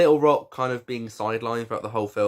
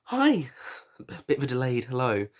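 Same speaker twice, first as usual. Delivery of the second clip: excited greeting. Real, not acted. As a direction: pop up and greet them brightly. Delayed greeting to viewers.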